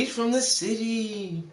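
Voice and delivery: put-on high, slow, dreamy girl; swooning girl voice. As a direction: sigh it out like a smitten girl. Mocking the smitten girl's reply.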